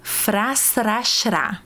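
Frasrachra said slowly, every consonant crisp; slow articulation drill. Shown as a demonstration. Teacher modelling the first syllable group slowly. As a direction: spell it out slowly in sound. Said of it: "a próxima sequência".

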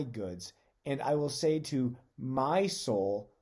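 slow, deliberate words, each "I" and "my" leaned on; emphasized scripture reading. Shown as a demonstration. Pastor stresses the rich man's words to his congregation, slow and weighty. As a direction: read it slowly, pressing the rich man's self-focus. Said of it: "I'm gonna emphasize certain words".

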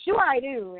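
light, cheerful imitation of an easygoing dad; mock-cheerful dad answering a stranger; happy-go-lucky. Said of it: "this happy-go-lucky voice".

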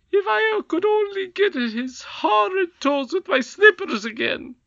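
high, loud squeaky threat; spiteful goblin queen. A narrator's reading. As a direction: squeal it out with relish.

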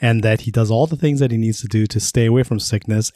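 ordinary talk

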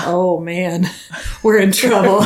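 words broken by laughter; laughing. Real, not acted. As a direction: laugh through the realization. Laughing at family agreeing.

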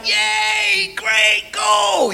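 high, drawn-out cheer; mock cheering. Parents seeing daughter off at the airport, mock cheering. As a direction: cheer them off in a put-on high voice. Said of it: "us like".

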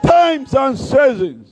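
high, very slow declaration; slow climax. Real, not acted. Preacher declaring the theme, high and slow. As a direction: let each word ring out.

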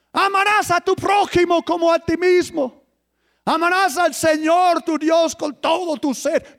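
high, forceful preaching recitation; thundering commandments. Acted. Preacher reciting God's commandments to the congregation, high-pitched forceful proclamation. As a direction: proclaim each commandment like a decree.

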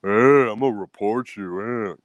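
slow, drawn-out mocking voice; mocking would-be reporter. Mimicking people who threaten to report scammers, mocking. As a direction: drag it out, making fun of the complainers. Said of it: "what the rest of fucking America does".

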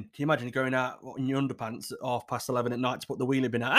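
ordinary talk

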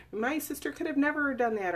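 disbelieving relative. Retold or imitated. Survivor mimicking a relative who refuses to believe, mock incredulous. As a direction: imitate a family member brushing it off. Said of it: "They don't wanna believe what happened".

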